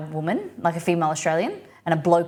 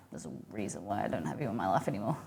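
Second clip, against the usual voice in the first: much lower and softer, quick muttered comeback; muttered retort; 11 semitones lower, 12 dB quieter, faster than usual. Retold or imitated. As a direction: grumble it under your breath. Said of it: "And I'm like".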